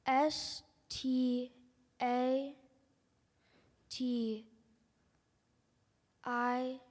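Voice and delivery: loud, slow letter by letter spelling. Real, not acted. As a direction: spell each letter out loudly.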